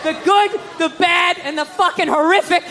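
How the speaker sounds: ordinary talk, nothing marked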